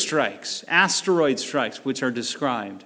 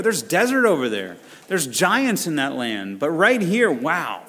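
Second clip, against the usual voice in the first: fast, bright daydreaming tourists at the shore; carefree Israelites at the beach; 4 semitones higher than usual. Retold or imitated. Israelites at the Red Sea shore, excited, carefree. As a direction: gush about beachfront freedom like a holiday crowd. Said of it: "I could imagine Israel ... going".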